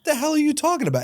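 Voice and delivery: high-pitched baffled retort; confused. Retold or imitated. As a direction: retell it baffled, pitch shooting right up. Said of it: "I'm legitimately confused".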